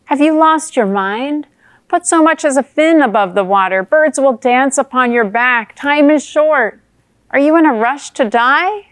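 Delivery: loud, high, incredulous warning; alarmed scolding. Acted. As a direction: scold a friend out of a fatal idea. Newt berates fish's reckless plan.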